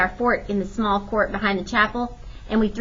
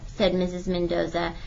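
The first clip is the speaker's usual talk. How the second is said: plain reading voice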